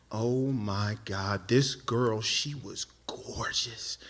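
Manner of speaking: low, slow and hushed awe; awestruck. Retold or imitated. Comic recalling first sight of the girl, awestruck. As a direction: let it fade into a near-whisper, savoring it. Awed recollection of first seeing her.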